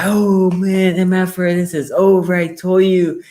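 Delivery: high, loud gloating; gloating taunt. Retold or imitated. Saints fan taunting him after a score, in a loud gloat. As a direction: rub the score in. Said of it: "he's like".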